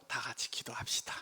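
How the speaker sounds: hushed unvoiced invitation; whispered call to pray. Pastor inviting all to pray over the boss, whispered. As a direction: whisper it reverently.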